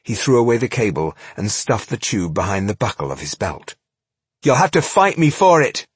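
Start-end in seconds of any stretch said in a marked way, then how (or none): 4.4-5.9 defiant dwarf. Acted. Dwarf challenges the Eoil.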